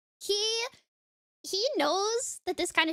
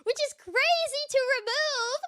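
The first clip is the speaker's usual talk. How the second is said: ordinary talk, nothing marked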